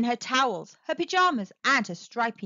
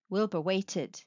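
ordinary delivery, nothing marked

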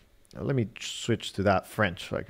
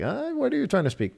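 much higher, baffled mock voice; confused phone. Phone baffled by his accent, much higher mock puzzlement. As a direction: play the phone, puzzled by bad French. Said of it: "probably it's like".

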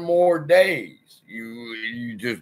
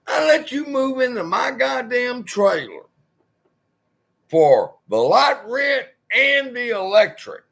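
raised, higher-pitched lecture to a freeloader; heated scolding; 7 semitones higher than usual. Retold or imitated. To the tenant who won't cut grass, heated scolding. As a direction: tear into him, voice pitched up.

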